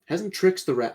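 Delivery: normal talking voice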